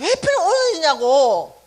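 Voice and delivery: loud, slow, indignant demand; hammed-up furious complaint to her father; mock rage, drawn out and loud; retold angry outburst. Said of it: "너무 화가 나는 거예요".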